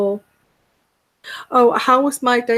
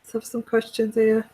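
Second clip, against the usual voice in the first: ordinary talk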